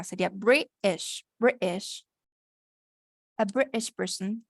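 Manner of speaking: British said Breesh with the T skipped, slow; T dropped. Shown as a demonstration. Teacher models the quick British form for the student. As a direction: drop the T from British. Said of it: "se saltan básicamente esta parte".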